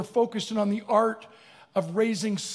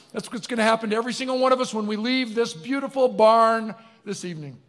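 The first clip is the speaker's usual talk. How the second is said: ordinary talk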